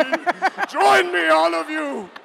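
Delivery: high, loud cult-leader summons; dark lord. Acted. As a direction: call the crowd to join you. Cult leader rallies the audience.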